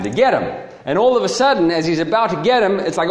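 ordinary delivery

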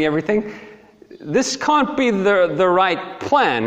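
high, indignant objection; protesting prophet. Acted. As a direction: object to God's plan in disbelief. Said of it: "And Habakkuk says".

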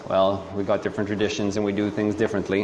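low, soft and quick; lightly voiced brushing-off of differences; mock casual dismissal. Voicing someone excusing a different gospel, casually dismissive.

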